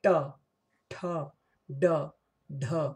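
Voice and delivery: ट ठ ड ढ each said separately, tongue curled up to the roof; retroflex series. Shown as a demonstration. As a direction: sound out ट ठ ड ढ one by one, slowly. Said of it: "ट बोल के देखिए". (मूर्धन्य वर्ण ट ठ ड ढ अलग-अलग बोलकर दिखाना)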